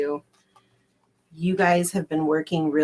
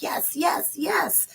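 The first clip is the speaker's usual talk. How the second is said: whispered yes; 8 semitones higher, 6 dB quieter, much slower than usual. Pretend. Cards voiced as saying yes.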